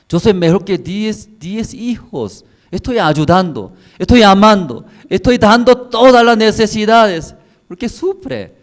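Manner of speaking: husband Elcana pleads with Ana, rising high and loud; insistent husband. Acted. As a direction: plead harder and harder for her to see his love.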